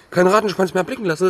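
usual speaking voice

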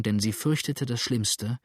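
plain reading voice

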